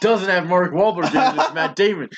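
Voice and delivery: high, helpless laughing storytelling. Real, not acted. Telling a friend about the actor mix-up, laughing. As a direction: tell it through laughter, pitch climbing.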